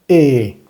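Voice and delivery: E as Spanish e, lips spread; drawn-out smiling E; accented French é. Shown as a demonstration. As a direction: say E clearly as é. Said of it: "la segunda que tiene acento".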